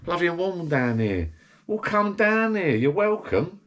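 high, slow mock-sweet wife voice; lady of the house, sweetly inviting; imitating his wife. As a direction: put on her high welcoming tone. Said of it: "she went".

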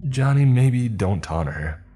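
nervous pleading. Acted. Nervous coworker.

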